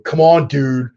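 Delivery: badly faked Texan, pushed high and loud; Texan guys complaining. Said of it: "all of my Texan guys would be going".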